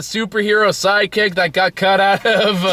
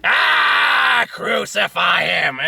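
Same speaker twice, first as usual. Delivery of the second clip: drawn-out, screeching comedian impression; Gilbert Gottfried; 6 semitones higher, much slower than usual. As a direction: yell it in a strained squawk. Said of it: "Gilbert Gottfried did a Jesus movie".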